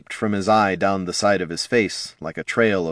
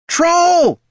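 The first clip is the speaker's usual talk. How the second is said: much higher, loud terrified shriek; scream; 8 dB louder than usual. A narrator's reading. Catha waking to see a troll, screaming in terror. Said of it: "Catha screamed".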